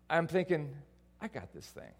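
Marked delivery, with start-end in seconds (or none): 1.2-1.9 low, hushed aside to himself; imitated confident inner voice; self-assured thought. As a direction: drop to a quiet, low murmur. His cocky thought, retold.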